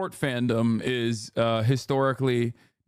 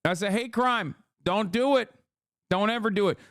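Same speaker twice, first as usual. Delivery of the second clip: higher, scolding hate crime; mock outrage; 7 semitones higher than usual. Streamer scolding chat about the slur, mock outrage. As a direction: wag a finger at the slur. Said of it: "I'm like".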